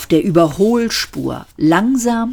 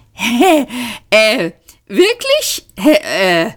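much higher, stammering embarrassed surprise; flustered surprise; 6 semitones higher, slower than usual. A narrator's reading. Sayford flustered when Arthur says they've met. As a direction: caught out, stumble over it. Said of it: "überrascht und verlegen".

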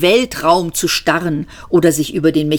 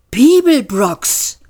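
gasped surprise; 6 semitones higher, slower than usual. Acted. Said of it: "keuchte Gar Gravars Geist überrascht".

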